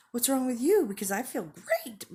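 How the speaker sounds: high, loud gushing mock cheer; overly happy. Speaker mimics someone in an overly happy mood swing, hammed-up glee. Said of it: "overly happy".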